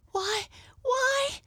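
put-on very high little-girl exclamation; astonished girl's cry. A narrator's reading. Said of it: "cried Mabel".

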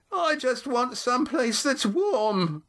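put-on the old woman piping up; shrill old woman. A narrator's reading. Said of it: "shrilled the old voice".

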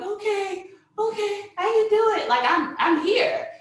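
high, breathless-eager retold resolve; acted self-pep talk. Mother in labor telling herself she can do it, high acted resolve. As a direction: act out bracing yourself, pitched high.

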